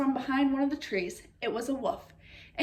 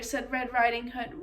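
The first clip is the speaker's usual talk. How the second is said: usual speaking voice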